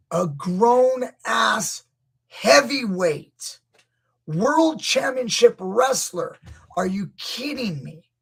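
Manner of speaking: slow, loud, high disbelief; mean rant. Real, not acted. Coach ranting at viewers, loud and incredulous. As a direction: scoff in outraged disbelief at the wrestler. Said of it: "I'm gonna sound a little mean".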